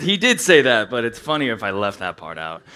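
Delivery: ordinary talk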